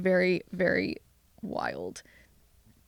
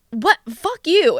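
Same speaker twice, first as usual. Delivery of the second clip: indignant outburst; 7 semitones higher, 5 dB louder, slower than usual. Retold or imitated. Acting out anger at other driver.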